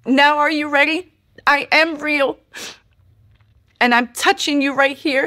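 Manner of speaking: high, tearful, awed; emotional retelling. Retold or imitated. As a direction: relive the holy moment near tears. Weeping, recalling God's words.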